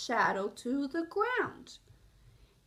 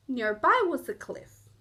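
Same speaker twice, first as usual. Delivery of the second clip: ordinary delivery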